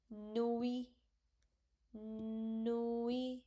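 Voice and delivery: "Nuit" said as "nui", the T dropped; silent T. Shown as a demonstration. Teacher drilling students on the silent T. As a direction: leave the T off "Nuit". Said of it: "You don't have to pronounce T".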